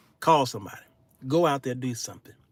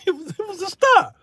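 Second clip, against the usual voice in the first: amazed; 4 dB louder than usual. Retold or imitated. Comic disbelief at a star.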